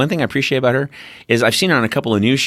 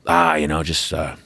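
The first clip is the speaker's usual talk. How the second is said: lower, softer, brushing off the question; evasive old pilot; 6 semitones lower, 3 dB quieter than usual. Retold or imitated. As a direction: shrug it off in a low mumble. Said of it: "That's all you got out of him".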